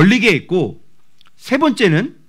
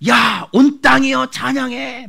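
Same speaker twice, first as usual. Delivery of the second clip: crazed praise; 5 semitones higher, slower than usual. Retold or imitated. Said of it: "막 이렇게 미친 사람처럼".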